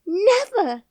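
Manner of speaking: high loud disbelieving cry; Never! flung out high and loud; shocked. A narrator's reading. Martha shocked.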